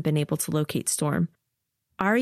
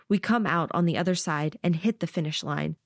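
ordinary delivery

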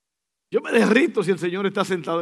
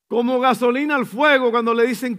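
ordinary talk

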